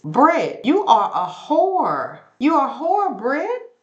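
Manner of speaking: high, drawn-out mock accusation; imitating Angela's cutting insult; acted insult. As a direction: spell the insult out slowly to sting.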